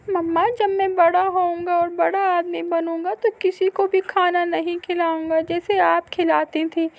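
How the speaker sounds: put-on high, loud complaining child; hurt little boy. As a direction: run to mother with the hurt. Boy vows. (माँ से ऊँची आवाज़ में शिकायत करता बच्चा)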